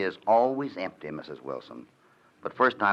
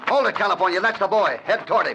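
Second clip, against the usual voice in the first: shouted orders, much higher and louder; urgent shouting; 12 semitones higher, 5 dB louder than usual. Acted. Rancher rallies his partner after gunshots, shouting orders. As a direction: bark commands over the danger.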